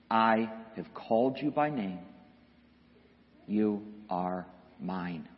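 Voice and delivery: low, soft, measured assurance; gentle reassurance. Acted. The shepherd claims his sheep, gently reassuring. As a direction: speak softly, with pauses, as a tender shepherd.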